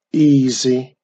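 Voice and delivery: loud, breezy witch reply; cocky witch. Acted. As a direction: toss it off with confidence. Witch answers confidently.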